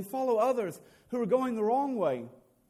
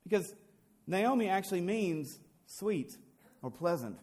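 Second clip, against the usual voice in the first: ordinary talk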